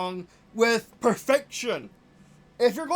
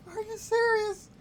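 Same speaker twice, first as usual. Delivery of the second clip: high-pitched, held-back incredulous question; high disbelief; 9 semitones higher, 3 dB quieter than usual. Real, not acted. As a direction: squeak it out high without yelling. Disbelief at failed game landing.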